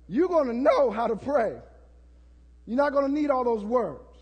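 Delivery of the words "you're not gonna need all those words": ordinary delivery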